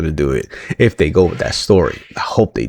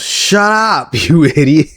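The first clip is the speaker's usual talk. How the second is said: mock Bayley snap; 7 semitones higher, slower than usual. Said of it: "She goes".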